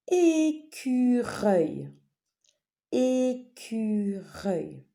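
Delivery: "Écureuil" very slowly as É-CU-REUIL; syllable-by-syllable. Shown as a demonstration. Teacher naming a picture for pupils, syllable by syllable. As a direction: stretch all three syllables of "Écureuil".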